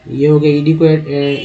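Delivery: ordinary talk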